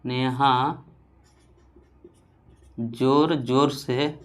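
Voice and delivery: soft and slow, word by word; slow dictation. Shown as a demonstration. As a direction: dictate gently for copying. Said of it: "एक उदाहरण आप समझिए". (धीरे-धीरे लिखवाना)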